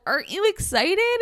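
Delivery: retold parental excitement; excited mom. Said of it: "my mom's like".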